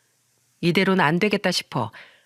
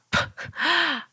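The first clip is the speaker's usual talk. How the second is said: high snort of laughter; spluttering mock laugh; 10 semitones higher, 3 dB quieter than usual. Drunk friend cracking up at the wife, derisive laugh. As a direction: burst out laughing mid-sentence.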